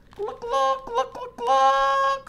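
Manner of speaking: very high clucking hen voice. Acted. Reader voices Hen clucking in the storm, very high. Hen's line in story.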